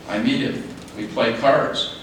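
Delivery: ordinary talk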